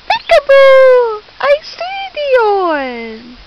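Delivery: put-on loud, high, drawn-out singsong to a baby; playful peekaboo. Mother playing peekaboo with her baby, high playful singsong. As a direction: play peekaboo with long swooping vowels.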